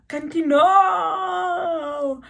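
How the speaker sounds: loud, high, long-drawn cry; dramatic wail. Retold or imitated. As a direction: stretch it out in a loud mock wail. Dramatic turn in her story.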